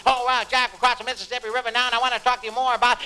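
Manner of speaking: fast, nonstop excited jazz rambler; Neil Cassidy. Acted. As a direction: riff breathlessly at your buddy Jack. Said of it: "And he said".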